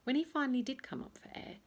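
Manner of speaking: usual speaking voice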